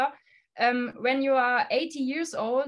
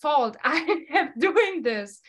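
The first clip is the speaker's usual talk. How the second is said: celebratory mock pride; 4 semitones higher, 4 dB louder, slower than usual. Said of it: "do a party ... and say".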